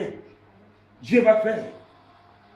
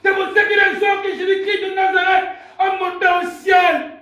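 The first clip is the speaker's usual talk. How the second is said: loud, high-pitched sermon cry; full-force preaching; 9 semitones higher, 8 dB louder than usual. Real, not acted. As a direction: proclaim it to the whole church. (prédication enflammée, criée)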